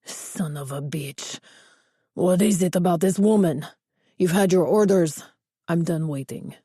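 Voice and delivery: put-on a threatening boss in thick Spanish accent. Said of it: "A heavy Spanish accent".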